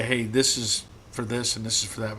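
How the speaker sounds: ordinary talk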